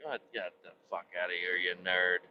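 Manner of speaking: low, quick contemptuous aside; dismissive mutter. Retold or imitated. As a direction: grumble it under your breath at the nerd. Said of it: "you're like".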